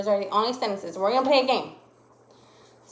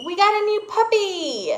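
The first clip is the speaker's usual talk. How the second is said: high, loud excited announcement; excited exclamation; 10 semitones higher, 6 dB louder than usual. Shown as a demonstration. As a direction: burst out with thrilled news. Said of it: "Did you sound excited".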